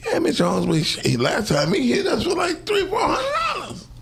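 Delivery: put-on a kid snitching, getting shriller; kid telling on him. The block kids tell Mister Jones about the winnings, high squeal. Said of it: "Pete, you know he thirteen".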